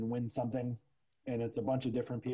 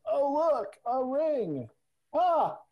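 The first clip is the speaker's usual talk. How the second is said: bad comic's reveal; 11 semitones higher, slower than usual. Comedian pulling props from a bag, high hammed-up delight. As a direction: ham up a cheap magic reveal. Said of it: "he'd be like".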